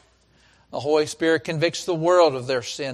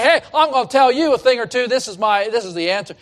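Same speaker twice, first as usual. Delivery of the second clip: loud, higher-pitched know-it-all announcement; broadcasting the answer; 6 semitones higher, 7 dB louder, faster than usual. Acted. Preacher mimics someone broadcasting their answer, loud and cocky. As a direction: broadcast it loudly, as if telling everyone off. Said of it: "don't just go broadcast it and say".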